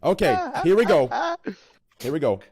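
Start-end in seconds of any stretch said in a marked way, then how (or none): none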